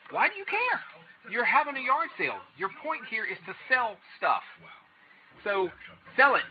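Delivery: high-pitched exasperated protest. Acted. Challenging yard sale sellers who won't sell to resellers, exasperated.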